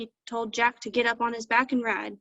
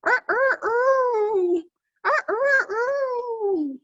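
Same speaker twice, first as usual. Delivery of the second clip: high, loud rooster crowing; very high cock-a-doodle imitation with long last note; lonesome rooster crow; 12 semitones higher, 5 dB louder, much slower than usual. A narrator's reading. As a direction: crow like an old rooster making a racket. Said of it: "crowing like it was midnight".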